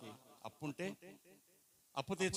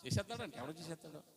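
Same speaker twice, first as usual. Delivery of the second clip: normal talking voice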